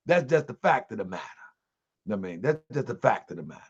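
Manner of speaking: under-breath confirmation, trailing away; muttered aside. Real, not acted. Trailing self-affirming aside.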